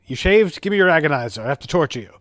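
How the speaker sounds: imitating an evil twin barking orders at his crew; mock angry Mirror Spock. Podcast host acting out Mirror Spock confronting the crew, mock angry. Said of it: "he's like".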